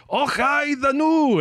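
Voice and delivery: high, slow, hammed-up Russian accent; a Russian worker trying out Scottish in a Russian accent; cheerful imitated Russian accent; mock Russian accent. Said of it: "some Russian guy went".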